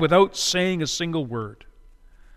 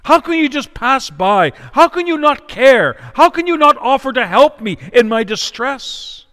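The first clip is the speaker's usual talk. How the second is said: high, loud pleading reproach; anguished reproach; 8 semitones higher, 7 dB louder than usual. Acted. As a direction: accuse the crowd with rising anguish. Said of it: "It's as though she was saying".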